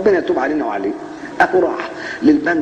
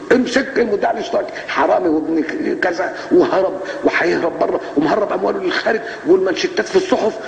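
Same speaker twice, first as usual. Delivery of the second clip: mock outcry; 6 semitones higher than usual. Mocking press uproar.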